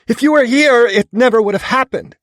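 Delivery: very high, loud anguished yell. A narrator's reading. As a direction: scream the blame at him. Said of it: "she yells".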